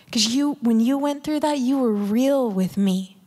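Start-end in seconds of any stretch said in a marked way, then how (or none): none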